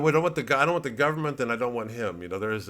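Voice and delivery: normal talking voice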